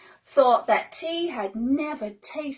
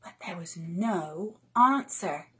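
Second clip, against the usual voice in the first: ordinary delivery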